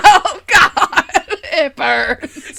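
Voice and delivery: hammed-up pain while laughing; mock agony. Imitating the actress hit in the crotch, mock agony. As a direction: groan it out for comedy, giggling.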